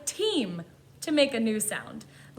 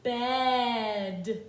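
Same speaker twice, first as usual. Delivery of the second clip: read slowly; 2 semitones lower than usual. Shown as a demonstration. Teacher sounding out a word for learners, slowly. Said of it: "Let's read it slowly".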